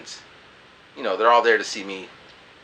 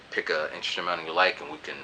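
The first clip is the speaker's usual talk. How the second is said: much softer and lower; shy thanks; 7 semitones lower, 12 dB quieter, faster than usual. Retold or imitated. Handing the girl his CD, shy and soft. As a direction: mumble it bashfully. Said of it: "I'm like".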